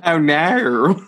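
hammed-up Australian "oh, naur"; mock Australian. Co-host riffing on the reader's accent joke, mock Australian. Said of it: "practicing my Australian accent".

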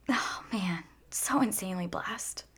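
soft breathy whisper of gratitude. Real, not acted. Overwhelmed by her friends' kindness, whispering. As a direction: barely voice it, overwhelmed.

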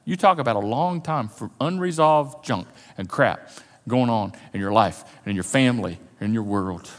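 ordinary delivery

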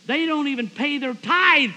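high, loud, slow mock denunciation; ranting and raving. Usher denounces his in-laws to the congregation, ranting and raving. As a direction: rant it out, drawing the words long. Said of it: "Bill is ranting and raving".

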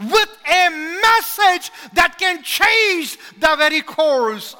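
loud, high, slow shouting preacher; fiery preaching. Acted. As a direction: cry it out to the rafters. Preaching at full force.

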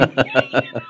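high bubbling laugh at the memory; giddy laugh. Real, not acted. Man laughing at his childhood prayer, giddy. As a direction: laugh it off, delighted.

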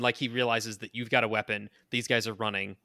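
usual speaking voice

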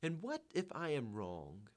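understated sudden realisation; slow, soft dawning doubt; sudden cry. A narrator's reading. Man doubting himself after a moment's thought, understated cry. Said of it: "he cried suddenly".

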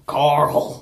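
choked, strained Carl; imitating a choking cry of a name; strangled voice. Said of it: "It sounds like he's choking".

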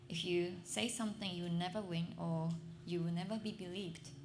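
ordinary talk

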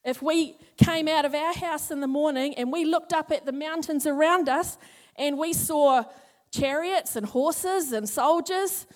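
normal talking voice

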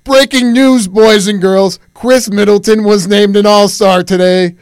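loud, high-pitched shouted bulletin; hammed-up news flash at full volume; mock breaking-news announcement. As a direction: burst in like an over-hyped announcer.

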